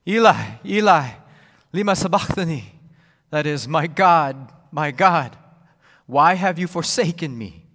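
a loud, desperate cry of abandonment. Acted. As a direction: cry out in anguish from the cross. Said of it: "cried out with a loud voice".

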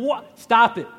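playfully refusing the dare; mock outrage. Mock reaction to Carmen's dare.

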